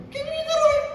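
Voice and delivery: a mock listener piping up; high squeaky interjection. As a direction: squeak the question very high and soft. Audience-style interjection, high squeak.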